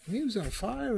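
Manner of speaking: excited emphasis. Real, not acted. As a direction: repeat "He was on fire" high and thrilled.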